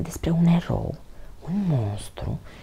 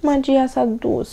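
tearful; 10 semitones higher, 5 dB louder than usual. A narrator's reading. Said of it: "spuse Toni printre lacrimi".

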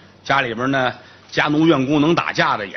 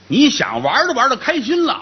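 normal talking voice

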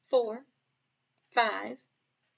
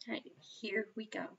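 whisper; 11 dB quieter, faster than usual. Shown as a demonstration. Teacher whispers before flash cards.

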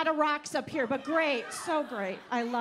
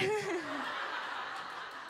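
laughing; 4 semitones higher, 9 dB quieter than usual. Real, not acted. Laughs at own joke.

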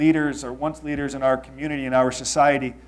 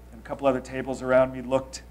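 ordinary delivery

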